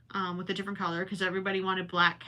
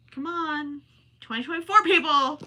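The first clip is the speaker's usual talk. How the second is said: high-pitched plea getting louder; exasperated protest; 7 semitones higher, 5 dB louder than usual. Real, not acted. Performer protesting to directors about the outdated ending, exasperated. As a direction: appeal to them in raised exasperation.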